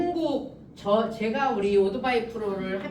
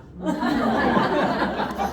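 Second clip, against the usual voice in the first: breathy laughter; 3 semitones higher, 4 dB louder than usual. Real, not acted. Laughs after punchline.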